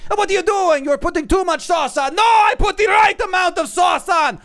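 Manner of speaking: high, shouted mock-Italian argument; bad Italian accent, yelling passionately high; poor Italian accent. Imitating two Italian chefs screaming over pizza, poor Italian accent. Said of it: "pardon my poor Italian accent".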